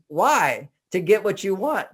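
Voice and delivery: normal talking voice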